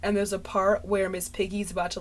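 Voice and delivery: ordinary talk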